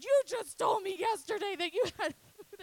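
very high, loud mock shriek; hammed-up parental outrage breaking into a laugh; outraged mom. Mom confronting her daughter over yesterday's lie, shrieking in mock outrage.

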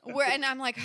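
ordinary delivery

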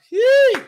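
very high, loud praise yelp; ecstatic yelp. Real, not acted. Preacher breaking into praise before the congregation, a high yelp. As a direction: let out a piercing joyful Yee.

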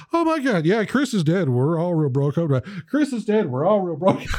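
high, gushing mock dismay; hammed-up shocked voice; mock shocked girl; lightly imitated shocked girl. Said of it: "retold exchange".

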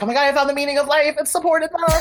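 high, fast, thrilled gush; like you just found the meaning of life; ecstatic revelation. Retold or imitated. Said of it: "I was like".

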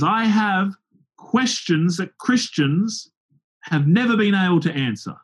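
mock heckler taunt. Preacher imitating the heckler boasting to the crowd, high and drawn out. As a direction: stretch it out high like a know-it-all heckler.

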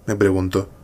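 ordinary delivery

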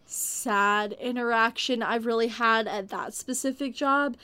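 ordinary delivery

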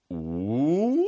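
long "woo" gliding upward; imitated choir warm-up swoop; low-to-high vocal siren. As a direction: slide the voice smoothly from low to high. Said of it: "He would go from low to high".

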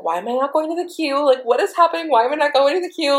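high, urgent retold panic; freaking out. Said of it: "I was freaking out".

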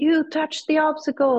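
high-pitched mock accusation; retold accusation. Retold marshal confronting her mid-race.

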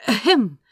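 loud fake throat-clear for attention; a polite, pointed ahem to the king. A narrator's reading.

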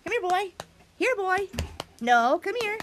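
high-pitched, short calls; calling a dog. Shown as a demonstration. Showing kids calling their doggy, high coaxing. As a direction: call the pet over in a high voice. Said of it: "Call your doggy to you".

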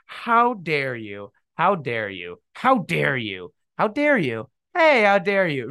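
mock-indignant, pitch leaping about. Shown as a demonstration. Podcaster showing the phrase fails however it is said, mocking. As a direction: try the line several mocking ways, each equally silly. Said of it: "it sounds like you're making fun of".

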